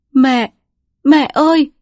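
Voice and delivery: gọi to, cao, hoảng hốt; kêu mẹ cuống cuồng; gọi mẹ thảng thốt. A narrator's reading. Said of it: "gọi mẹ thảng thốt". (con gái gọi mẹ thảng thốt)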